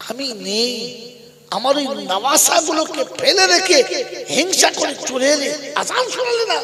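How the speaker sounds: high, loud wail of reproach; loud preacher's plaintive cry; impassioned reproach. Acted. Prophet reproaches Bilal in dream.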